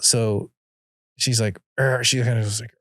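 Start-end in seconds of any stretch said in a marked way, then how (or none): 1.8-2.0 short imitated kid growl; frustrated growl. Dad imitating his frustrated daughter, a brief growl. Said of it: "she just growls".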